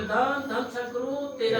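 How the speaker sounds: high, drawn-out devotional cry; calling out the slogan. Retold or imitated. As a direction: imitate a desperate devotee calling the slogan aloud, slowly. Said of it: "ऐसे ऐसे बोला".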